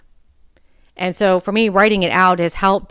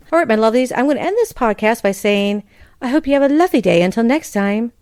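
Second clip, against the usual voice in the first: higher, brighter host voice wrapping up the episode; podcast sign-off; 3 semitones higher than usual. Acted. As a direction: wave goodbye to the listeners, upbeat.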